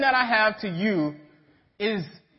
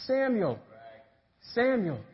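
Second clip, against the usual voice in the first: soft, falling call; voice calling a name; 6 dB quieter than usual. Acted. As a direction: call out gently, as if from afar. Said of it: "he hears somebody say".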